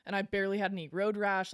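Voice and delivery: normal talking voice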